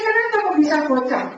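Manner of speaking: shocked, loud and high "Kenapa kok bisa bocor?"; alarmed question. Retold or imitated. Preacher retelling asking the doctor, high and shocked. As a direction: demand the reason in a rising alarmed burst.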